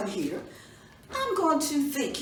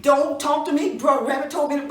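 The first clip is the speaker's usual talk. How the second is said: angry father; 4 semitones higher, 8 dB louder, faster than usual. Acted. Father cuts her off.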